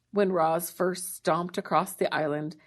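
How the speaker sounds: ordinary delivery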